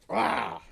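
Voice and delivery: short, loud, rough yelp; cry of pain. Acted. GM as orc stepping into spiked growth, harsh pained cry. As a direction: roar as if stung in the leg.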